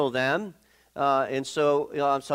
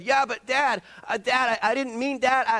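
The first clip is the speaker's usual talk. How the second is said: put-on high pleading boy voice; pleading son; 9 semitones higher than usual. Preacher voicing his son pleading innocence, high. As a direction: plead like a kid caught out. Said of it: "and he said".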